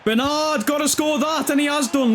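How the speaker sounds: high-pitched, urgent, then celebrating; will him to score, then cheer it; elated commentary, voice pitched right up; excited goal call. Real, not acted.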